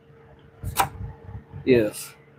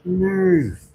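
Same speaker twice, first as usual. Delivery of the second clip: "Nerve" raised and falling, mock outrage; indignant nerve; 7 semitones higher, 3 dB louder than usual. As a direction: snap "Nerve" as the offended hand, a touch louder. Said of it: "my hand is mad at me".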